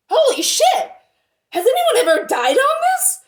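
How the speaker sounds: retold panicked yelp, loud and very high; freaking out. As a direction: blurt it in mock alarm, pitch shooting up. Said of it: "I was freaking out".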